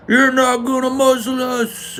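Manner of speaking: loud, drawn-out high-pitched mock yell; hammed-up screech of defiance; mock protesting lady. Dumbass lady stands up at a mask meeting, hammed-up defiant yell. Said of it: "some fucking dumbass lady stands up and says".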